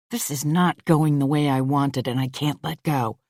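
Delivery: ordinary delivery, nothing marked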